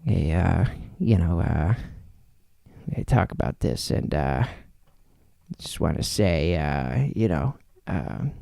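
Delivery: low, soft, hesitant serious voice; awkward, quiet, sincere-comedian imitation; Adam Sandler. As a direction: mumble it nervously, full of uhs. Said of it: "when he's serious, is like".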